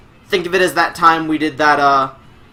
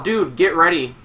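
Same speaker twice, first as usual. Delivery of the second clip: ordinary talk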